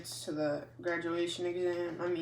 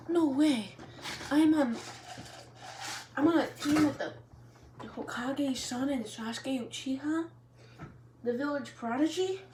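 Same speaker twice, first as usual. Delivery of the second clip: high, loud gushing; girl gushing over her teammates, higher; thrilled; 8 semitones higher, 3 dB louder than usual. Acted. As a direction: gush like a starstruck fan. Sakura thrilled about her teammates.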